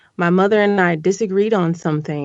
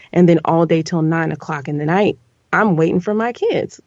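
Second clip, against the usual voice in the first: ordinary delivery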